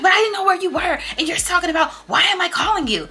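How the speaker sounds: high, loud whiny friend voice; mimicking Coco upset. Friend Coco quoted in quarrel.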